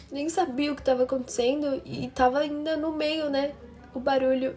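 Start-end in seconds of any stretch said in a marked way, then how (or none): none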